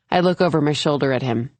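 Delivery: ordinary delivery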